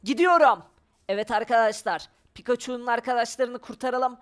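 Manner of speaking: usual speaking voice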